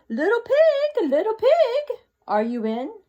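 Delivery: high, loud sing-song call. Acted. Wolf calling at the straw house, loud sing-song. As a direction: call to the pig through the door, slowly. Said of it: "so I called".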